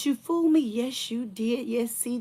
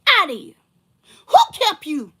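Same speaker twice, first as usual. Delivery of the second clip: angry; 7 semitones higher, 10 dB louder than usual. Real, not acted. Angry callout at ex-friend.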